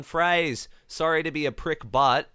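shouting down. Retold or imitated. Retelling a contestant interrupting a pair on the swing, shouted. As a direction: call it over to someone, mimicking a shout. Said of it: "he shouts down".

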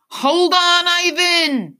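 high, loud, urgent yell. A narrator's reading. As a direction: yell it to someone far off. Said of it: "I yell".

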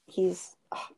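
breathy swooning whisper over a crush. Retold or imitated. Girl whispering to her friend about her celebrity crush, swooning. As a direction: sigh it out like a fangirl.

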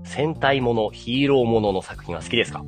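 ordinary talk

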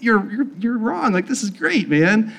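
high, excited retold insistence; eager insistence. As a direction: cheerfully push back on the doubters. Poking the skeptical pastors.

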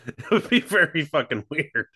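laughing while speaking. Real, not acted. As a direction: say it through a laugh. Laughing reply.

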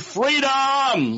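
high drawn-out movie-quote cry; retold scream. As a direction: cry it out high and long, like a film battle cry. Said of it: "She screamed".

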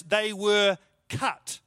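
"they were cut" drawn out very slowly; slow and weighty. Shown as a demonstration. Preacher reading the King James wording, drawn out. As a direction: let each word land heavily. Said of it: "doesn't sound as bad as furious".

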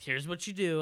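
bossy instructions, played up a little; mock bossy teacher. Friend mocking the teacher's instructions, mock bossy tone.

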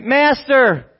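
loud, high impassioned plea; drawn-out begging yell; crying out. Acted. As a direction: shout it as a desperate appeal. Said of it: "They're crying out".

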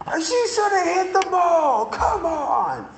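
mock fan yelling. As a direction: play a heckling fan. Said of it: "you're yelling at the batter".

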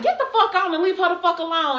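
high, indignant retold order; cussing out. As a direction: re-enact ordering someone off. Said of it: "cussed him the fuck out".

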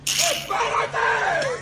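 very high, long-stretched shout; drawn-out yell. Acted. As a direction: holler it out slowly at a high pitch. Shouting out in mock quarrel.

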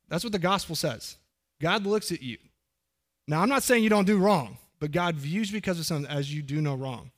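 ordinary delivery

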